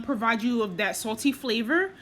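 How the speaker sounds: ordinary talk, nothing marked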